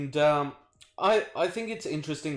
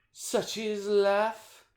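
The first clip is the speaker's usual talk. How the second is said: drawn-out sigh; 10 semitones higher, much slower than usual. Acted. Resigned sigh over bad week.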